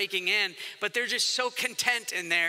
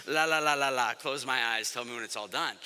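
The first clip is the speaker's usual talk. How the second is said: mock tuning-out; 8 semitones lower than usual. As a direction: drone through it in a low voice, pretending not to hear. Acts out tuning out fear.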